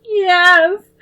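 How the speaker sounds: high, loud, crying; tearful scream. A narrator's reading. Little girl joining her sister's angry scream. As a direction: shriek it through tears. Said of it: "Debbie screamed".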